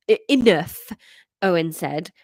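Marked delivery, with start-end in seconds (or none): 0.1-0.9 put-on mildly angry, louder Welsh teen. Teenage son interrupts his father, mildly angry. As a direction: cut in with a touch of anger. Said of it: "I don't know why he's angry".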